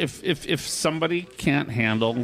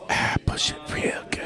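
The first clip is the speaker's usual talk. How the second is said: whispering; 3 dB quieter than usual. Acted. Whispers the song line.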